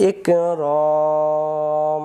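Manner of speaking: ইকরম with the vowel stretched long; three alif stretch. Shown as a demonstration. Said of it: "তিন আলিফ টান দিতে হবে".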